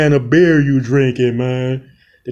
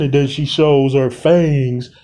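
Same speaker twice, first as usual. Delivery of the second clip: ordinary delivery, nothing marked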